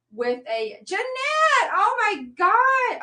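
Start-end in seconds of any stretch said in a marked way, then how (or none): 0.8-1.7 loud, high call of a name; sudden high yell to get her attention. Real, not acted. Calling out to someone suddenly. 1.7-3.0 loud, high, drawn-out oh my God; stretched-out exclamation of surprise; startled. Real, not acted. Startled exclamation after calling out.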